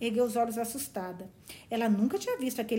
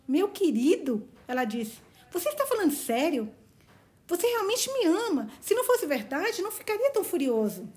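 much higher, delighted realization; overjoyed Valancy; 7 semitones higher, 3 dB louder than usual. Acted. Valancy realizing Barney loves her, overjoyed. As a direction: break into joyful disbelief. Reader voices Valancy's joy.

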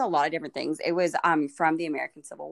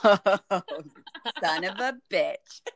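slow, drawn-out laughing curse; mock outrage; much slower than usual. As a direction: stretch out a fake shocked curse.

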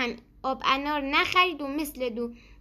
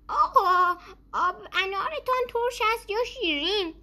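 put-on old man asking; 8 semitones higher than usual. A narrator's reading. Old man asks the vendor.

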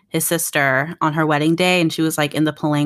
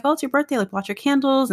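gentle mom voice, pitched higher; mom's voice; 8 semitones higher than usual. Retold or imitated. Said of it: "and she was just like".

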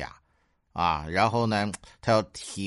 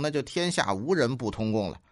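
ordinary talk, nothing marked

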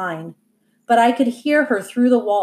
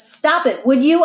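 irritated snap; 6 semitones higher, 5 dB louder than usual. Acted. Dad turns on wife.

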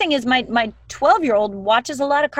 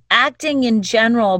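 ordinary talk

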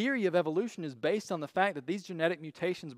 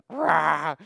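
short loud mock "Rah!" roar; little angry roar; 6 dB louder than usual. Tiny cartoon bird roaring, a short loud mock roar. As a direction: bark a cute little battle cry. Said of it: "little angry bird that goes".

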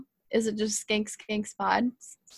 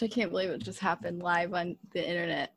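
softer, quick embarrassed aside; mock embarrassed murmur; 4 semitones lower, 7 dB quieter, faster than usual. Real, not acted. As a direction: mutter it in mock shame. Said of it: "Oh my gosh".

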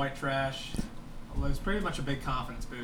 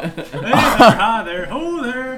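louder and higher with each hello; goofy rising greeting; 9 semitones higher, 15 dB louder, much slower than usual. Acted. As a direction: build a silly cheer, laughing.

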